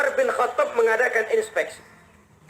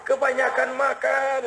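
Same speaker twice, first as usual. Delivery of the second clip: mock groaning; 5 semitones higher than usual. Overfed man confesses why his belly hurts, mock groaning. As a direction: wail it as an overfed sufferer. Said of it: "orang ngerintih kayak tadi".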